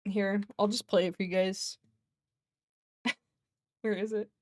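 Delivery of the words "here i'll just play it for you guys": ordinary talk, nothing marked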